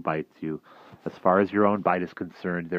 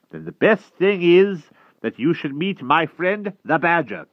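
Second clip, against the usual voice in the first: put-on raised, lively old wizard; 11 semitones higher, 7 dB louder than usual. A narrator's reading. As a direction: voice the wizard Merlin higher. Merlin voiced in read story.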